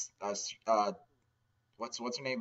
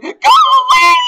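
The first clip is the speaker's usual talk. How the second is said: much higher, much louder screamed go away; mock shout; 20 dB louder, slower than usual. Man in the corner yelling at the visitor, loud scream. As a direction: scream it to drive them off.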